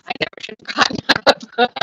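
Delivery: gasping out the words; breathless after running around; out of breath. Real, not acted. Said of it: "trying to catch my breath".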